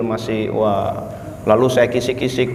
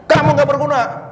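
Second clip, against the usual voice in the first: high, scornful yell; angry boss yell; 14 semitones higher than usual. Retold or imitated. Boss telling employee he is useless, angry yell. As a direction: belittle him at full pitch.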